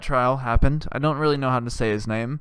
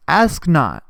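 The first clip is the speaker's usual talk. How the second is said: ordinary talk, nothing marked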